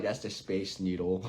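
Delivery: low, soft, matter-of-fact answer; deadpan local. Retold or imitated. Local answering a curious visitor, low and deadpan. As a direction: drop the voice low and quiet, unimpressed.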